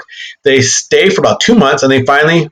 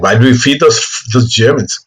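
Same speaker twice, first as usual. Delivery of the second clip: put-on botched French accent sounding German; French accent slipping into German; failed French accent; 2 semitones lower than usual. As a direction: attempt French, land nearer German. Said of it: "that sounded like German".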